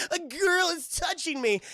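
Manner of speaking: high, drawn-out mock squeal; flustered Aang. Blushing boy as Katara takes his arm, mock squeal. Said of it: "He's blushing".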